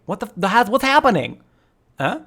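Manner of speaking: high, loud, stumbling alarm; startled confusion. Real, not acted. Streamer caught off guard by a game twist, startled confusion. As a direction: blurt it out in confusion.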